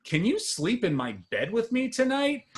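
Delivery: put-on high, sweet child asking; little kid voice. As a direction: ask like an innocent little boy. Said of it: "and I quote".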